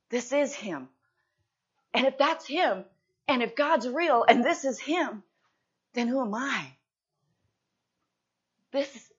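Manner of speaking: impassioned pulpit cry of a man who sees his sin; preaching at full force. Acted. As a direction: drive home the thief's realization. Preaching the thief's realization.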